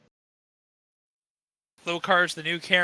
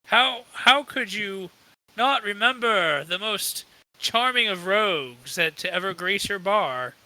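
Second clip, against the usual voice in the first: hammed-up wounded rogue; dramatic. Charming rogue protests to the elf who forgot him, dramatic. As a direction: lament theatrically, drawing out the key words. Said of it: "Theo starts acting dramatic".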